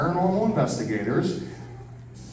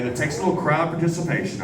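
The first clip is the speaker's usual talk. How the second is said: usual speaking voice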